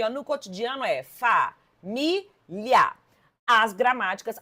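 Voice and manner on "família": "família" as fa, mi, lia with pauses; three separate syllables. Shown as a demonstration. Teacher giving the everyday separation, "família" in three beats. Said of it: "normal, comum aí".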